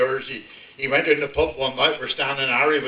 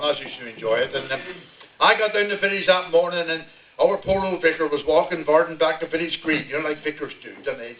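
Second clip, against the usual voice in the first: normal talking voice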